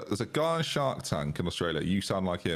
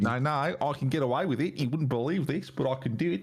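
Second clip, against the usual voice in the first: higher, mock English accent gone Australian; 6 semitones higher than usual. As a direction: imitate a friend's botched English accent. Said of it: "is that what I sound like to you".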